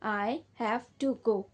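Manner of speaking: very slow, each word spaced out; slow dictation. Shown as a demonstration. Teacher repeating the sentence slowly for her students. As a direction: spell it out slowly for the class. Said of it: "okay बच्चों".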